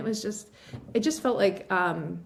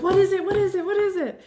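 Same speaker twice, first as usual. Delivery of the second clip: high and loud, eager repeated asking; excited pestering; 12 semitones higher, 6 dB louder than usual. Retold or imitated. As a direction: friends at the pool begging for the news. Friends beg to hear news.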